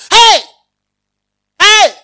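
loud, high shouted hey; mock shout. Shown as a demonstration. Preacher acts out a spouse shouting at a partner. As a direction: bark it out like a fed-up spouse. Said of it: "It's not shouting".

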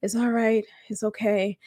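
soft, quiet reassurance to oneself; soothing self-talk. Retold or imitated. Telling herself to breathe while crying, soft soothing. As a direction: gently talk yourself down, under your voice. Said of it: "I'm telling myself to breathe".

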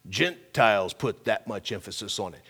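normal talking voice

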